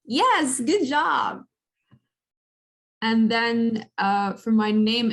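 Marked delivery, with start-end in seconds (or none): 0.0-1.4 pleased praise. Real, not acted. Praising student's try.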